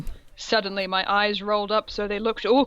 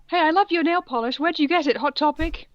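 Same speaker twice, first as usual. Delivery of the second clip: perky teacher voice; 7 semitones higher, 3 dB louder than usual. Acted. Voicing female teacher.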